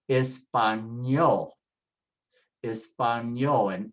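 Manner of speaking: español, slow and syllable by syllable to ÑOL; stress on last syllable. Shown as a demonstration. Teacher models the rule, slowly stressing español on ÑOL. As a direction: say español slowly, weight on the end. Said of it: "Everything is on the last syllable now".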